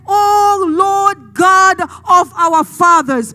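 very drawn-out call to God; king's fervent invocation. Acted. Preacher voicing the king as he remembers God's victories, fervent invocation. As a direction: stretch every word out in fervent prayer. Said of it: "he's remembering all this and say".

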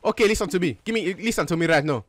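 demanding, put-on African accent. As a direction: press for answers in a mock African accent. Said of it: "so you don't have an African accent".

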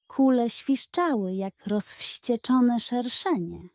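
plain reading voice

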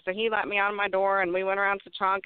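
ordinary talk, nothing marked

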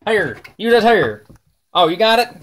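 ordinary delivery

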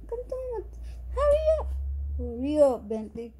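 high sing-song calling a pet; calling the dog. Real, not acted. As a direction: coax the dog to come to mama. Calling her dog over.

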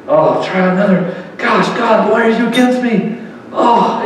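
a hint of self-pitying whine to God; lightly voiced grumbling victim; whiny old self. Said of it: "We moan, we whine".

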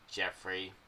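usual speaking voice

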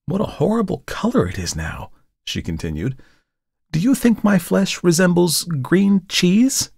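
high, mocking young woman's voice; Tessie, teasing model. A narrator's reading. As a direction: needle him playfully.

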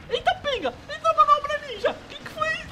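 high loud panicked yelps; panicking gamer. Real, not acted. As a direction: shriek in alarm at the screen. Wild enemy maneuver, panicking.

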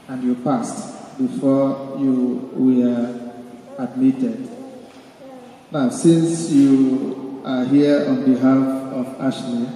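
ordinary delivery

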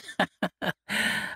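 breathy mocking laugh. A narrator's reading. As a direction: laugh at him with scorn. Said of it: "Ashmara laughed out the words".

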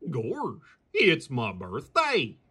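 usual speaking voice

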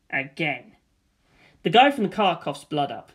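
ordinary delivery

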